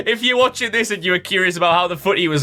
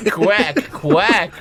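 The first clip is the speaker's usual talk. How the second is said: higher mock American duck call; American-accented quack; 3 semitones higher, much slower than usual. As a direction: repeat the quack with a hammed-up American vowel. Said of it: "Why did he go American?".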